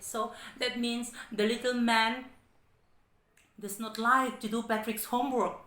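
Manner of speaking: ordinary talk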